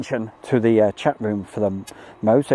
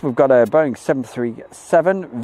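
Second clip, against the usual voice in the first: normal talking voice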